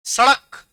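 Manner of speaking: सड़क with a quick retroflex flap ṛ; सड़क with ड़ flapped, never a hard ḍ. Shown as a demonstration. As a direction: flick the tongue out in सड़क, "sa-ṛak". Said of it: "जीभ देखना तेजी से बाहर आएगी".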